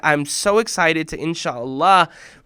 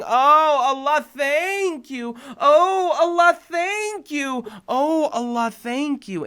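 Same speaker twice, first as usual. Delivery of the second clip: mock loud thanks; 9 semitones higher, 4 dB louder, slower than usual. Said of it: "screaming at the top of your lungs".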